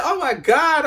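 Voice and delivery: loud, high panicked oh my God; loud high freak-out; panicked outburst. Retold or imitated. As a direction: blurt it out in a high-pitched panic. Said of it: "I'm like".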